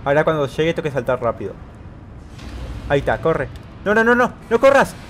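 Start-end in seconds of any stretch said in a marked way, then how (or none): 3.8-4.9 high, loud urgent stop; panicked shout. Real, not acted. Player telling the character not to run, panicked. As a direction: shout to halt the runner in alarm.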